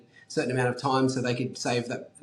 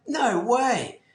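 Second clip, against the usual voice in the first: high, stunned "no way"; can't believe it; high pitched retold disbelief; amazed disbelief; 9 semitones higher than usual. Amazed at Ferrari owner.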